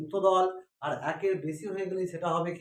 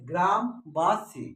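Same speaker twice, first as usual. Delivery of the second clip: গ্রামবাসী drawn out syllable by syllable, very slow; slow, syllable-by-syllable teaching voice; slowly split into syllables; much slower than usual. Shown as a demonstration. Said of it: "আবার গ্রামবাসী দেখো".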